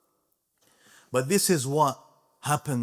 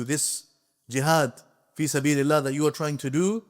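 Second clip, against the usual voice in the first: ordinary talk, nothing marked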